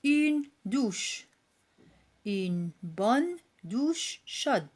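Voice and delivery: very slow, words drawn out; slow model reading. Shown as a demonstration. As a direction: read it slowly for the pupils. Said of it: "Des mots". (lecture modèle très lente)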